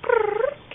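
high, loud rolled brrr; imitated cat trill. Owner coaxes Kitty Cat to talk, high imitated trill.

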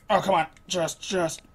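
ordinary talk, nothing marked

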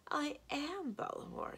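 slow, high, softened sweetness; creepily sweet, stretched out slowly; artificially pleasant. A narrator's reading. Giantess names herself to visitors, artificially pleasant. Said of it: "still in an artificially pleasant tone".

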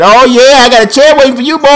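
high, loud taunting yell; hyped trash talk. Real, not acted. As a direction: crow loudly at your opponent. Trash talk at game opponent.

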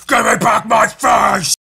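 slow, high roaring rasp; raging shout. Acted. Comic reader acting Rorschach demanding his face, raging raspy shout. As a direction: bellow it hoarsely, drawing out each word.

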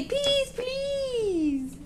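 put-on high soft cooed words for baby to copy; soft high cooing model. Shown as a demonstration. Mother modelling words for baby, soft high coo.

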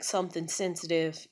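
ordinary talk, nothing marked

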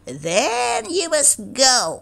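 high, slow dismissive animal voice; cat and hen. A narrator's reading. Cat and hen sending the duckling away, high and firm. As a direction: send him off firmly. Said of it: "said the cat and the hen".

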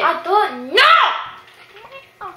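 stretched, loud, high shout; drawn-out shout. Real, not acted. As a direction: drag out the yell in protest.

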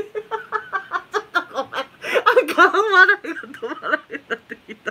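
loud laugh-talk breaking through; can't stop laughing. Real, not acted. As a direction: apologize while the giggles take over. (笑いが止まらない)

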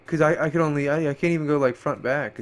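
normal talking voice

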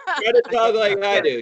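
put-on British accent; playful posh British accent; mock Queen's English accent. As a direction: coach him in an imitation Queen's English. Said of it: "Get a Queen's accent".

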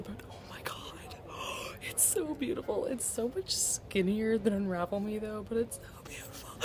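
hushed, breathless whisper with a small gasp; awed whisper. Real, not acted. Holding the new book alone in the store, an awed whisper. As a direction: whisper over a treasure.

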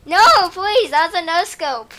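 loud, high pleading protest; excited pleading. Real, not acted. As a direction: beg it out loudly. Getting shot, pleading loudly.

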